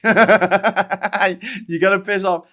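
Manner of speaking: slow, high, laughing retort. Real, not acted. Streamer laughing back at a cheeky viewer. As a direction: laugh it out in disbelief.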